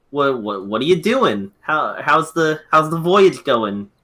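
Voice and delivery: ordinary delivery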